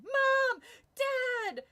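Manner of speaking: put-on terrified boy running downstairs, shrieking for his parents. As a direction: scream for your parents at the top of your lungs.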